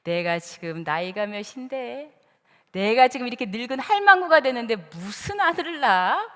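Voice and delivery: put-on old woman's disbelief. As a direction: grumble to yourself like a doubting old wife. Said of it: "사라는 이렇게 생각합니다". (늙은 할머니가 기막혀하는 혼잣말)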